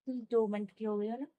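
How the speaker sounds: ordinary talk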